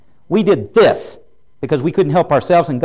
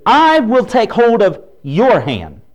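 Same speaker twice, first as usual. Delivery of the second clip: slow, higher, louder divine promise; voice of God; 6 semitones higher, 4 dB louder, slower than usual. Acted. Preacher voicing God to the congregation, slow and raised. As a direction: lay out each word as God's own vow.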